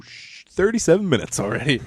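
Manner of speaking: ordinary delivery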